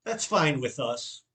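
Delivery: ordinary delivery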